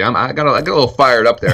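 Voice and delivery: ordinary talk, nothing marked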